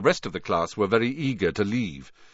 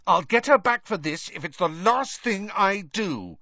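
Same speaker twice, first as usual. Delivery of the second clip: vengeful; 11 semitones higher, 4 dB louder than usual. A narrator's reading. Hermione vowing revenge.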